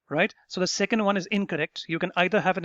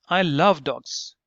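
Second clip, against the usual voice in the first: LOVE drawn out slowly and louder; exclamation; 5 dB louder, much slower than usual. Shown as a demonstration. Teacher's example of an exclamatory sentence, LOVE stretched and stressed. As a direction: lean hard on a long LOVE. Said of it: "ends with an exclamation mark".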